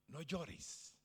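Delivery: gentle comfort. Acted. Jesus comforting the crying widow.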